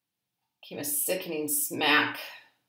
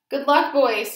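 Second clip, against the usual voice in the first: high, loud cry of farewell; cried farewell; 8 semitones higher, 10 dB louder than usual. A narrator's reading. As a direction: call it out to the barbaloots. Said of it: "he cried".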